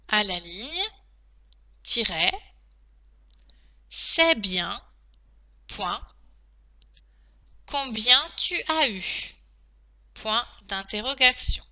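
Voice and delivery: slow, deliberate dictation with pauses; dictating. Shown as a demonstration. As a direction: dictate each phrase clearly to pupils. Teacher dictating to class.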